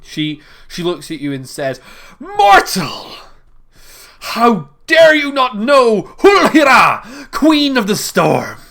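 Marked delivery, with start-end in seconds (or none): 2.2-8.6 high, slow, thundering shout; furious goddess. Acted. Storm queen shouts at Philippe for not knowing her, furious. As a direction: roar at the mortal like a storm.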